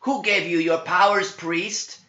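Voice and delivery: high, loud spitting demon voice; spat demon question. Acted. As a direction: spit the question at him. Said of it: "spit John".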